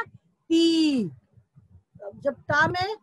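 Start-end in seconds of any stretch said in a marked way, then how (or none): 0.0-1.0 ती loud, with a long ee; long vowel. Shown as a demonstration. Teacher showing how it was pronounced, loud long-held ती. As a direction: hold the ee in ती and push it out. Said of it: "तो कैसे उच्चारण किया था?".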